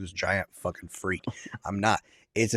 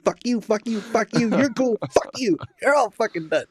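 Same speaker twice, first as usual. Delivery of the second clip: giddy freedom; 12 semitones higher than usual. Retold or imitated. After the pregnancy scare, high gleeful taunting of everyone. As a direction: toss each one off up high, laughing free.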